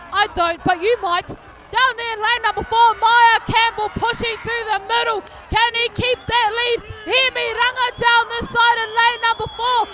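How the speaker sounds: loud, high live play-by-play building to a roar; excited race call. Real, not acted. Commentator calling the waka final to viewers, rising into shouting. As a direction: call the finish like the crowd's on its feet.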